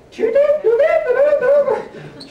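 very high mock squeaky commands at the TV; bossy channel-changing. Lazy husband on couch, high mock "do that" commands. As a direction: bark at the screen in a high mock voice. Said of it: "or I'll be like".

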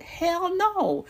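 mocking hell no to her own question; emphatic hell no. Real, not acted. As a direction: fling it out high and loud. Answering own rhetorical question.

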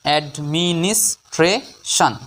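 slow, in five separate syllables; teacher's slow syllable-split demonstration. Shown as a demonstration. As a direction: break the word into measured pieces. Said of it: "পাঁচ সিলেবালে ভাঙব".

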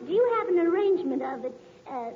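ordinary talk

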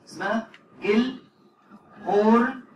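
name drawn out, rising; spelling out the name. Shown as a demonstration. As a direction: present the name Gel Horn slowly and clearly. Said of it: "عليك اسمها بس".

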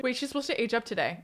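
usual speaking voice

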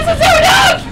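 yelling. Real, not acted. Gamer outburst.

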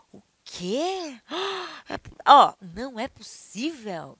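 loud droga then deflated sighs; frustrated. Shown as a demonstration. Teacher demos frustration, loud then deflated. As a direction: groan at failure. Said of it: "aversão ou contrariedade".

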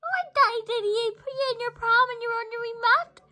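very high sing-song teasing; mother mocking the baby's complaint in a high coo; high baby talk. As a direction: tease the baby in a high cooing voice. Voicing a mock dialogue.

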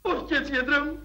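tearful plea. Acted. Husband breaks down to doctor.

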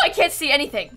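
ordinary talk

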